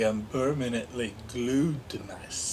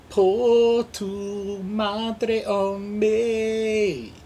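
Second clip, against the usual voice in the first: mock Spanish accent; 6 semitones higher, much slower than usual.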